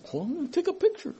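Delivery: high, light, playful mimicry; playfully high-pitched mock voice; mock selfie-taker. Mimes someone taking a selfie.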